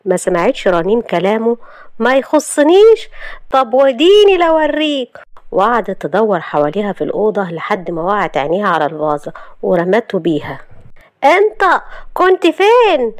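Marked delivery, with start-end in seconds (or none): 2.0-5.2 slow, high, loud outrage; enraged threat. Acted. Wife about to hurl a vase at husband, enraged. 11.2-13.1 very high, drawn-out yell; furious demand. Acted. Wife hurling a vase at husband, furious.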